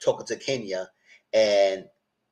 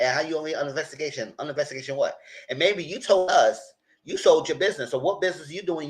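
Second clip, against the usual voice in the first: ordinary talk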